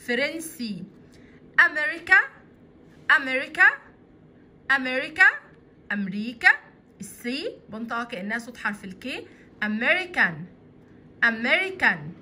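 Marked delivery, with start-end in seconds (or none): 1.6-6.5 أمريكا called out loud, rising each time; teacher drilling America; model word. Shown as a demonstration. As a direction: repeat أمريكا loudly, lifting the end. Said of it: "تعالوا نشوفهم كده".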